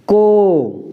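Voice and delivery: को said as a clear long "ko"; teacher sounding out "को"; ka with o matra. Shown as a demonstration. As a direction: model "को" for pupils. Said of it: "तो हम इसको पढ़ेंगे".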